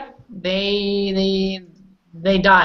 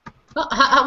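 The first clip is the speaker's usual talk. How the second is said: normal talking voice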